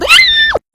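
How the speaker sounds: sudden very high, loud shriek; real startled scream. Real, not acted. Storyteller startled by falling bug, screaming. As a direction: genuine jump-scare scream.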